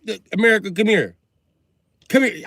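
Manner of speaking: high, loud parental call; calling the kid. Acted. Comic as parent calling America like a kid, high and loud. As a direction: summon the brat sternly.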